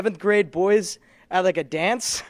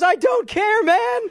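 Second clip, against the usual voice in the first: pitched way up, a shrugging "I don't care, man"; high mock dismissive voice; 13 semitones higher, 4 dB louder, slower than usual. Kicker brushes off the kid, high squeaky mock shrug. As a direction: toss it off in a squeaky mocking voice. Kicker's reply retold, voice shifted.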